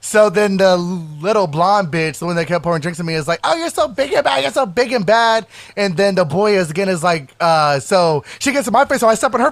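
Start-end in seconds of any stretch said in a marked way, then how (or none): 3.4-5.5 high-pitched mocking imitation of a woman; mocking taunt. Imitating the blonde woman in a fight, mocking taunt. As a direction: mimic a sarcastic taunt up high.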